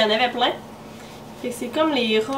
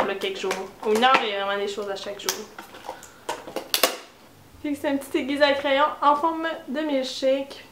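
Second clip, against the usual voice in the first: ordinary talk, nothing marked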